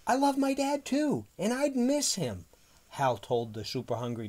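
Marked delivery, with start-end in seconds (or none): 0.1-2.5 put-on very high, loud child character; high-pitched protest. A narrator's reading. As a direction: plead for dad in a high little voice. Child pleading with monster in story.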